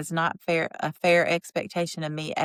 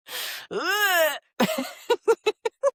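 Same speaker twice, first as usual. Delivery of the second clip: high, crying-like laugh; helpless high laughter; squealing laugh; 13 semitones higher than usual. Real, not acted. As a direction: break into a shrieking laugh.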